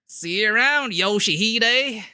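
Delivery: put-on Southern accent. Streamer repeating the cowboy line in a bad Southern accent. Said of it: "we do a pretty terrible Southern accent".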